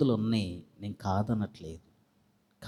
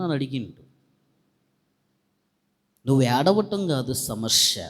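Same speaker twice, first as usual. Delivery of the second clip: fervent preaching; 3 semitones higher, 6 dB louder than usual. Acted. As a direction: preach it out to the congregation with fire. Preacher rises to full force. (ఉద్వేగంతో బిగ్గరగా ప్రసంగం)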